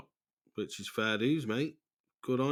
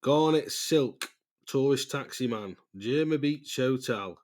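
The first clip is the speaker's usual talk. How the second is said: Garnet Silk read out slow like an advert; announcing slowly; 3 semitones higher, slower than usual. Shown as a demonstration. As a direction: spell out the contact details, slow and proud. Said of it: "I'll tell you right now".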